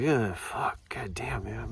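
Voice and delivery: hushed, whispered curse; muttered dread. Retold or imitated. Dreading his turn to share, whispered curse. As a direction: mutter it under your breath. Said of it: "and I was like".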